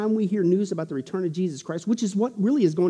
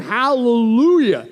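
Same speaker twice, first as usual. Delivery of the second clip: long drawn-out hallelujah; preacher voicing a heavenly shout, slowly; shouted praise; 4 semitones higher, 6 dB louder, much slower than usual. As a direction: stretch it like a crowd's acclamation.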